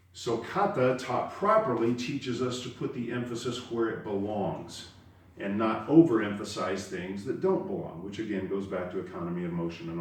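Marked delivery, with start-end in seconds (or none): none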